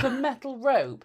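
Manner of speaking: loud, slow echo in disbelief; amused disbelief, drawn out; incredulous. Real, not acted. Incredulous at friend's odd phrase.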